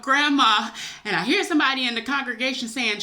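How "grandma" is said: higher, louder mock scream; retold scream. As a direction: cry out as if reliving the scream. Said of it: "I scream out".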